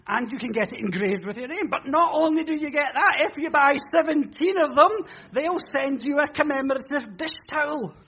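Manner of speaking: preacher parodies a TV seller piling on extras; hammed-up advert pitch. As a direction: escalate the pitch with each bonus, laughing at the end. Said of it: "shopping channel pitch escalating".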